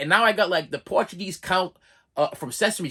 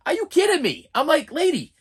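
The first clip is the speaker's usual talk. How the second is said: much higher, exasperated squeak; incredulous; 11 semitones higher, 4 dB louder than usual. Retold or imitated. Patient to the counting nurse, much higher and incredulous. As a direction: shoot up in disbelief.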